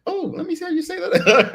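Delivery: squealing, high and laughing; a delighted woman, laughing; high-pitched lady voice. Retold or imitated. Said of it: "sounding this way has helped me with the ladies".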